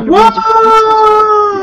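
squealing wah; high wail. Retold or imitated. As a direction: screech it like a bolt. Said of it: "they're like".

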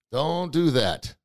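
DON'T DO THAT stretched slowly; slow right down, stretching each word; drawn-out warning. Real, not acted. Host warning listeners not to challenge God, drawn out.

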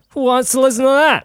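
high, loud mock disbelief; mock outburst. Host running down his own podcast, high mock outburst. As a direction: exclaim it high and incredulous.

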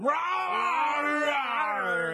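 loud playful beast roar; monster roar. Acted. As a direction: charge after them roaring. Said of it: "we scream".

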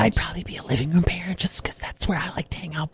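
hushed whisper; whispering on the phone. Real, not acted. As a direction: whisper it so nobody hears. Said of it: "I have to whisper".

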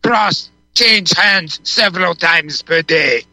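put-on slow Yiddish-accented quote. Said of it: "practicing a Yiddish accent".

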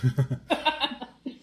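high burst of laughter. Real, not acted. Players laugh at goblin joke.

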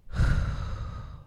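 slow breathy sigh out; long audible exhale. Shown as a demonstration. Host showing listeners how to breathe out, long exhale.

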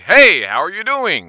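loud, high, swooping movie-phone greeting; obnoxious announcer voice. Shown as a demonstration. Host mocking his show voice, loud and clearly obnoxious. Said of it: "do it like that so that it's clearly obnoxious".

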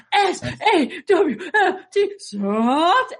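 high, slow spelled-out chant; Enzo and Big Cass. Retold or imitated. Woman teaching work friends a wrestling catchphrase, imitated voice. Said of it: "what their talking is".

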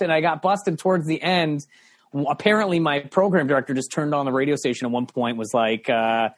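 usual speaking voice

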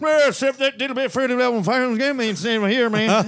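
high, garbled mumbling caricature; mock unintelligible mumbler; marble-mouth impression. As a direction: slur the words together as if the mouth is full of marbles. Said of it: "suffers from chronic marble mouth".

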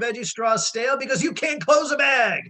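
high-pitched scolding, rising in exasperation; exasperated rant. Retold or imitated. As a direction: re-enact a fed-up parent lecturing the kids. Acted rant at kids over stale snacks.

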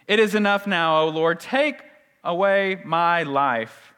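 loud, slowing cry to God; desperate plea. Acted. Elijah begging the Lord to end it, desperate plea. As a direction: cry out dramatically, drawing out the last words.

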